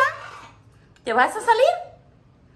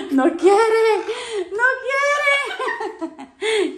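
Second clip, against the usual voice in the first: laughing; 7 semitones higher, much slower than usual. Real, not acted. Laughing at pet refusing.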